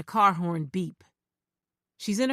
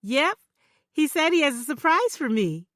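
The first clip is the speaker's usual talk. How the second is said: put-on high, bright, happy girl; gushing with delight; joyous; 7 semitones higher, 4 dB louder than usual. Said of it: "Her joyous, shrill laughter".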